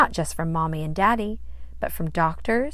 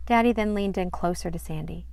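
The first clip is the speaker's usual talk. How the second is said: ordinary delivery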